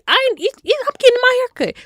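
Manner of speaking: mock indignant high protest; exasperated squeak. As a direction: blurt it out all worked up. Her exasperated retort retold.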